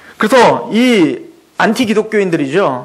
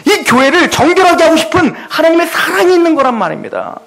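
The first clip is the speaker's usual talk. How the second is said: raised, higher pleading sermon voice with rising intensity; impassioned preaching; 6 semitones higher than usual. Acted. Preacher rebukes the nations for mocking the church, impassioned preaching. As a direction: pour out God's grieved anger at the congregation. Preaching builds to full force.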